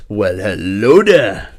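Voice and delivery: loud, slow, drawn-out catchphrase. Retold or imitated. Repeating a friend's deathmatch catchphrase, showy sly greeting. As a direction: swoop up on HELLO and stretch it out. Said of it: "that needs to be your catchphrase".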